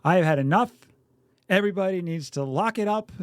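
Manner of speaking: imitating his own driving outburst; a parent at the wheel, fed up, mock yelling. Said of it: "I will definitely yell".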